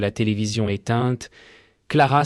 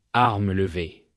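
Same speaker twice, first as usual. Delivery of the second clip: ordinary delivery, nothing marked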